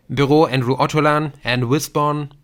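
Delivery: high-pitched, off-putting receptionist; unpleasant secretary. A narrator's reading. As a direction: answer the office phone in a high, unwelcoming voice. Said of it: "die unangenehme Stimme von vorhin".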